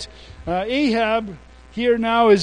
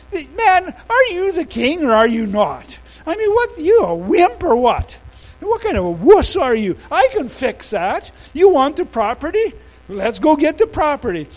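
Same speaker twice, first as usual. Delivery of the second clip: higher, scornful nagging wife voice, louder; mocking Jezebel; 6 semitones higher, 3 dB louder than usual. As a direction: goad the weak king with high-pitched scorn. Jezebel taunting Ahab in retelling.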